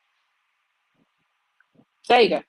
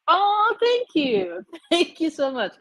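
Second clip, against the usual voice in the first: delighted thanks; 10 semitones higher, 3 dB louder, slower than usual. Real, not acted. Touched by viewer gift.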